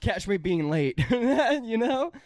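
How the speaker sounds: laughing. Real, not acted. Laughing at own story.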